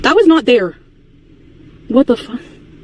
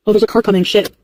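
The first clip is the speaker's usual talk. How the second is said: usual speaking voice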